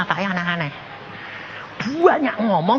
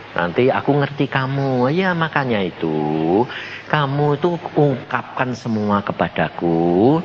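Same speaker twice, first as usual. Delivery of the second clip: gentle voice; 13 semitones lower, 3 dB quieter than usual. Retold or imitated. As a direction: speak as a patient, comforting Lord. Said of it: "Lembut. Ngomongnya gini".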